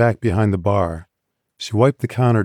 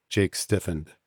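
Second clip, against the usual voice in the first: plain reading voice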